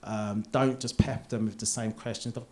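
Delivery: normal talking voice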